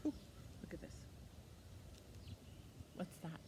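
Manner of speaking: ordinary talk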